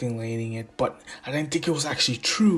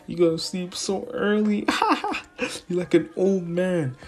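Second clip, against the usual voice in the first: high teasing; 7 semitones higher than usual. Retold or imitated. Teasing friend on phone.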